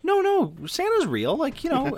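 very high, loud mock mom insisting; a mom frantically denying Santa. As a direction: squeak it out like a flustered mom. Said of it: "And my mom goes".

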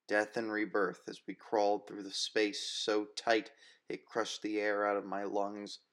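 ordinary delivery

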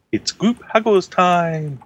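high, drawn-out group-hug call; rally everyone for a hug, slow and high; singsong summons to a cuddle; calling out playfully. Acted.

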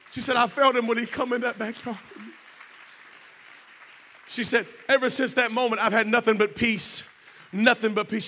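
loud, high testimony delivery; impassioned preaching. Retold or imitated. Preacher retelling a mother finding peace, impassioned and loud. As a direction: belt out the testimony to the congregation.

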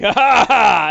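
hammed-up villain laugh; orc laughing. Orc rising to his feet with a loud mocking laugh. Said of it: "And he's like".

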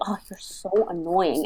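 low, soft grumble; imitated muttered complaint; under the breath. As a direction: grumble it low so a parent won't hear. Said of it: "I would say it under my breath".

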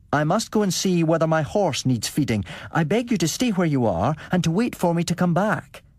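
pitched high to carry. A narrator's reading. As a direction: speak up so others hear. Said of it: "I raised my voice".

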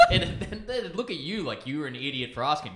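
ordinary talk

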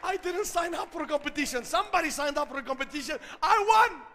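building high and loud to a shouted finish; crowing, hammed-up winner; triumphant mock protest. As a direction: climb from protest to a gleeful shout. Comic retelling of claiming the prize.

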